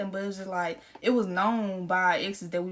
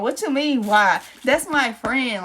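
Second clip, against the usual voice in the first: loud, indignant what you mean why; retold outrage; 3 semitones higher, 7 dB louder, slower than usual. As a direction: push it out, offended. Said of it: "retold outraged text".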